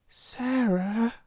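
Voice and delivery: a timid, high Sarah; mild awe-struck call. A narrator's reading. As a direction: call her name shyly. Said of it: "in a timid, almost awe-stricken voice".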